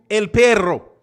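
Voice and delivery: mock Spanish accent, coaxing the dog; lightly put-on Spanish accent talking to the dog. Calling the dog in a Spanish accent, coaxing. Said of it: "when we move".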